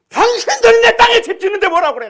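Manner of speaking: very high, loud shouted challenge to neighbors; angry retort. Retold or imitated. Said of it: "막 그냥 대들어 버렸어". (동네 사람들에게 큰소리로 대드는 목사)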